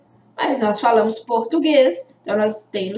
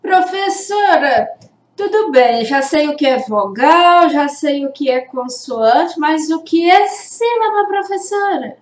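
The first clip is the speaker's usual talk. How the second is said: questioning pupil; 4 semitones higher, 4 dB louder than usual. Acted. Pupil asks teacher what a syllable is, questioning pupil voice. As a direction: play a pupil calling on the teacher.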